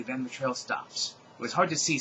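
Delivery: ordinary delivery, nothing marked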